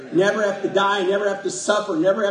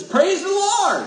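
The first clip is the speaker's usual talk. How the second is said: much higher, slow, ringing "Praise the Lord"; exuberant praise; 11 semitones higher, slower than usual. Shown as a demonstration. Pastor showing the emotional way of praising, exuberant and high. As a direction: let it burst out with joy. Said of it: "That's just as good as".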